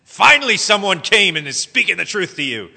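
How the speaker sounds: loud, mock-triumphant wife to husband; nudging wife. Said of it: "wanting so desperately to elbow your husband".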